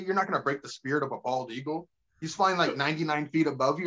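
ordinary talk

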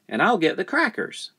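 put-on high childlike offer; high-pitched little helper; child joining in. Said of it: "added the child".

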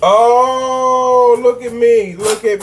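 high, loud look-at-me squeal; gleeful high show-off call; excited showing off. Real, not acted. Showing off the food.

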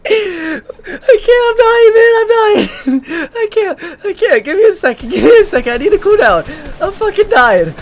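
high, cracking words through real laughter; laughing helplessly. Real, not acted. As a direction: barely get words out while laughing. Can't stop laughing after kill.

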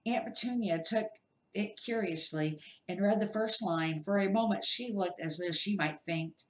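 ordinary delivery, nothing marked

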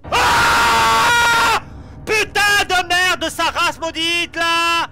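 drawn-out frightened curse; scared screaming. Real, not acted. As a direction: scream and swear slowly in fright. Jump scare in horror game.

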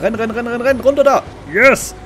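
high, urgent cheering on; excited shouting. Real, not acted. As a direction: yell him across, then triumph. Urging his character to run.

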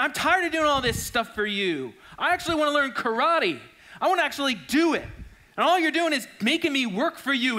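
put-on a fed-up kid venting at his teacher; frustrated complaint. Fed-up student complaining loudly to his teacher. Daniel ticked off at Miyagi.

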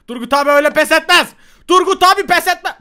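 high, very loud self-hype; defiant rallying cry. Pretend. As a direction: roar that you never give up. Kendini gaza getiren haykırış. (yüksek, tiz sesle meydan okuma)

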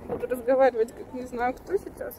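talking with a numb swollen cheek. Real, not acted. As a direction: speak through a numbed, puffy cheek. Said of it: "у меня щека опухла".

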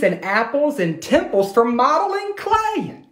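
put-on lively read-aloud to children; animated picture-book reading; storybook narration. A narrator's reading. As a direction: tell it like a bedtime story.

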